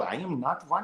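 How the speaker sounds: soft mock Indian accent; imitated Indian accent. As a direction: put on a quick Indian accent in passing. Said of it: "do the Indian accent".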